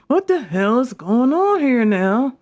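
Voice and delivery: high, slow, drawn-out disbelief; mock-baffled cry at the crime scene; exasperated what the hell. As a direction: stretch it out like you can't believe the mess. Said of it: "my favorite thing to say".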